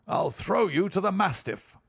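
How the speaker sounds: high, snarling threat; grin of rage. A narrator's reading. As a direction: spit out the threat with fury. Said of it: "the smile hardened into a grin of rage".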